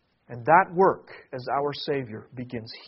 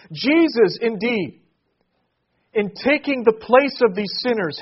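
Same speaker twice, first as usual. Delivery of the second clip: higher, much louder climactic preaching; preaching at full force; 7 semitones higher, 10 dB louder than usual. Acted. Pastor telling congregation Jesus fulfils Israel's hope, preaching full force. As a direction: build to a ringing proclamation.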